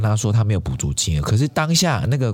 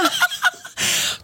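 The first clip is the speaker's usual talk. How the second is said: high breathy burst of laughter. Real, not acted. Laughing at the claim there's no service department, high disbelieving laugh.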